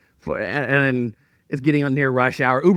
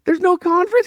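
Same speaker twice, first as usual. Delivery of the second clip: disbelief; 13 semitones higher than usual. Retold or imitated. Asking desk clerk in disbelief.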